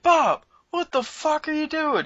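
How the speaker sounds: high, exasperated mock yell at a friend. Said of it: "It's like".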